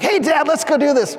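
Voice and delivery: put-on eager kid. Kid asking dad to go out, lightly voiced eagerness. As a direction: chirp it like an excited child. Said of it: "the kid's like".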